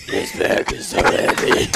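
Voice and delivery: slow, scary voiceless rasp, played for laughs; mock Batman voice. Friend mocking his buddy under a heavy pack, scary Batman rasp. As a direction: imitate a winded friend straining out a Batman growl. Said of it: "talking in the scariest voice ever".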